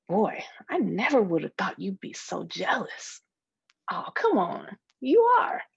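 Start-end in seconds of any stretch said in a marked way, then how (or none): 3.9-5.6 high, loud singsong tease; teasing accusation. Acted. Friend teasing her about jealousy, high and loud. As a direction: needle her with a playful jab.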